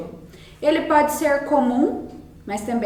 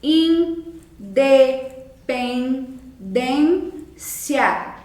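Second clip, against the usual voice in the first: "Independência" very slowly, split into separate syllables; much slower than usual. Shown as a demonstration. Teacher helping pupils count syllables, "Independência" said syllable by syllable. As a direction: break "Independência" into its syllables with a pause after each. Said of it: "quantas sílabas tem?".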